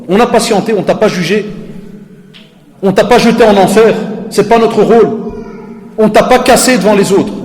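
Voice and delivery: loud, high, preaching rebuke; impassioned rebuke. Retold or imitated. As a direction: pour it all out at him, full force. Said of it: "Je dis".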